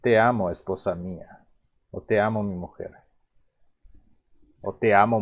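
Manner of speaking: normal talking voice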